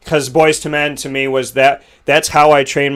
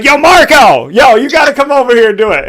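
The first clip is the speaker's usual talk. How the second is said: much higher and louder shout; calling out; 10 semitones higher, 7 dB louder than usual. Retold or imitated. Singer calling his friend Marco to the mic, loud. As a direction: call your friend across the room.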